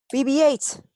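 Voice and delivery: high, loud summons; urgent shout. Acted. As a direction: yell for a droid across the battlefield. Summoning droid in battle.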